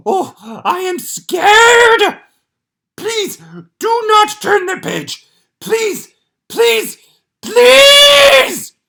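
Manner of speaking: high, loud, slow terrified begging; frightened pleading. Acted. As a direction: plead in mock terror as Grover. Grover begging not to turn.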